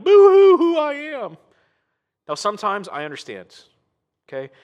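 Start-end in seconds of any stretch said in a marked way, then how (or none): 0.0-1.4 mock sobbing whine, much higher and louder; hammed-up crybaby wail mocking self-pity; mock boo-hoo whine. Preacher mocks a whining prayer with fake sobbing whine.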